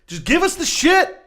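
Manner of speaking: slow mocking yell; mock exasperated shout. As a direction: blurt it out as the fed-up office clerk. Mocking office staff demand.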